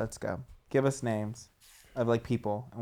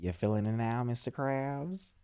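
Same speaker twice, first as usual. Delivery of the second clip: SpongeBob impression; 6 semitones lower, 9 dB quieter than usual. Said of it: "SpongeBob SquarePants".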